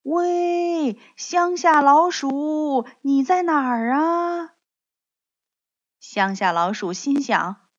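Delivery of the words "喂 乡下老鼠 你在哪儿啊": high, drawn-out call; long, ringing singsong shout; calling out loudly. A narrator's reading. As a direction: holler for a friend across open fields. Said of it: "大声喊着".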